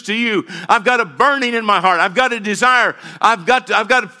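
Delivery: voicing Paul to the church with passion; fervent preaching. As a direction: preach it with burning urgency. Preaching Paul's words fervently.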